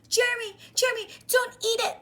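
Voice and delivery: high, loud, fast plea; urgent warning. Acted. Boy begging his friend not to eat the pie. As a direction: shout to stop him in time.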